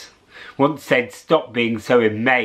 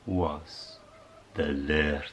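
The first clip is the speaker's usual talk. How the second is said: plain reading voice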